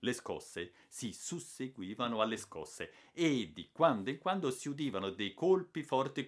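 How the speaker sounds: ordinary delivery, nothing marked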